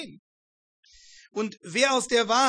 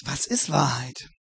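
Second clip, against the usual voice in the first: thoughtful; 7 semitones lower than usual. Acted. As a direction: ask it as if weighing the question. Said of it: "Ob er das nachdenklich sagt". (nachdenklich, leiser und tiefer)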